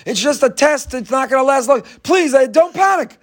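high-pitched mock panic; panicking announcer. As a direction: plead over a loudspeaker, pitch climbing. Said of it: "the only guy panicking".

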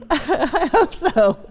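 high, slow laugh through the words. Real, not acted. Pastor laughing at his own joke to the congregation, amused. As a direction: chuckle it out, amused.

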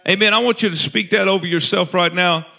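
loud, high, driving preacher cry; preaching at full force. Acted. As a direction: rise up and shout the truth over them.

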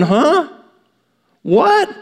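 voicing the crowd's baffled huh, what; bewildered huh. Pastor voicing the confused listeners: baffled surprise. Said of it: "And they're going".

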